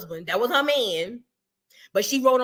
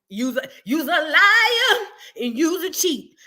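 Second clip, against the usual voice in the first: higher, drawn-out teasing accusation; mock scolding; 4 semitones higher, 3 dB louder, slower than usual. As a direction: scold her slowly with a laugh in it. Mock scolding her great-grandmother.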